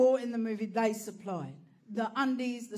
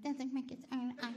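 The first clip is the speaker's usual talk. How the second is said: mocking echo; 9 dB quieter than usual. Mocking rich friends' question.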